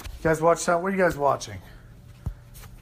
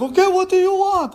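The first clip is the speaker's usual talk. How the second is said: much higher, louder, caving in; mock frightened dealer giving in; scared salesman; 14 semitones higher, 4 dB louder than usual. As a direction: squeak it out like he's suddenly afraid. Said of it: "this fear comes over this, this guy's face".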